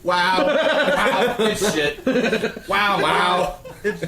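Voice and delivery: very high, loud mocking wows; imitating the wow. Host mocks co-host's squeal, very high and loud. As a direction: echo his squeal back, laughing.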